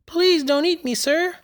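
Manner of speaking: high, pleading mouse voice. Acted. The mouse begging the lion not to eat him, high squeaky plea. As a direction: beg like a tiny mouse. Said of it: "the mouse pleaded".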